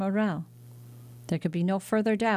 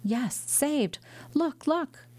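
eager urging; 8 semitones higher, much slower than usual. Acted. Daughter urging father to look.

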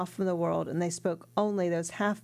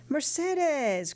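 happy call from outside; 8 semitones higher than usual. Acted. Returning sailor calling his sweetheart from outside, joyful high call. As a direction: call out to her from beyond the door, overjoyed. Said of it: "cried a happy voice outside the house".

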